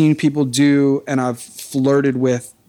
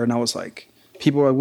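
usual speaking voice

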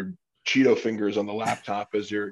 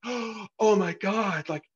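high, slow, swooning fan gush; hammed-up fangirl swoon over the podcaster; mock gushing fan; 9 semitones higher, slower than usual. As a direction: gasp and gush like a starstruck fan. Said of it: "trying to have an impact of like".

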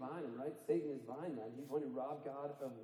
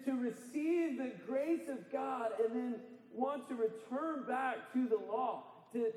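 raised, driving preacher voice; preaching with full force; 6 semitones higher than usual. Acted. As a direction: preach it hard to the congregation. Preaching the admonition.

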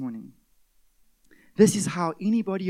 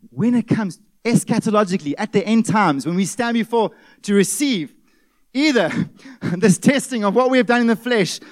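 louder, pressing sermon delivery with a laugh inside; intense preaching; 3 semitones higher, 7 dB louder than usual. Acted. Pastor warning of judgment fire, intense preaching. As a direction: build the warning with urgency.

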